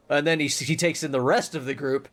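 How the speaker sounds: normal talking voice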